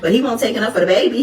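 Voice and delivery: usual speaking voice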